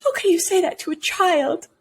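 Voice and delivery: high, shocked crowd voice; scandalized gasp. Retold or imitated. Bystanders react to Scrooge insulting a child, high mock shock.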